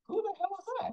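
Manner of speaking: high, incredulous mock squeal; imitating his own clueless reaction, pitched far up; baffled. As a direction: play up the confusion, way up high. Retold baffled reply about stranger.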